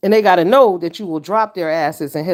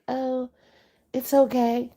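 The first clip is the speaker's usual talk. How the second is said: mock parent cooing it's okay, higher and much softer; soft permissive parent; 8 semitones higher, 3 dB quieter, slower than usual. Parent soothing a badly behaved kid, mock soft coo. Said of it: "parents that are like".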